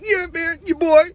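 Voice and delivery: high-pitched, hammed-up rapper impression; badly faked rapper voice, jumping way up high. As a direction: put on a bad, squeaky-high rapper voice.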